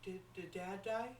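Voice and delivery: slow, halting, rising question; hesitant child's question. Acted. Child asks mom if dad died, hesitant. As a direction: falter on the first word, lifting at the end. Child asking about father's death.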